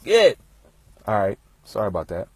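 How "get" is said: sudden sharp yell to scare off bees; shooing bees. Real, not acted. Speaker yelling at bees that interrupt his story, sudden loud shout. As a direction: bark it out loud to chase the bees off.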